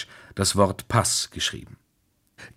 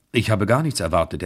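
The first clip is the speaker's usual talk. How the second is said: ordinary delivery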